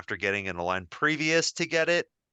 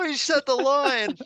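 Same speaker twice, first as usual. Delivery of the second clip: high-pitched, gleeful fan outburst; starstruck squeal; 13 semitones higher, 4 dB louder than usual. Retold or imitated. Fan after the actor said the line, high starstruck squeal. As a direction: gush in a high, thrilled voice.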